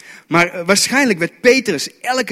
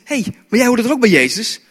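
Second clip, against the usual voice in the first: higher, louder acted questioning; acted pointed question, pitched up; challenging little girl; 7 semitones higher, 5 dB louder than usual. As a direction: pipe up as a child who recognises someone. Voices girl challenging Peter.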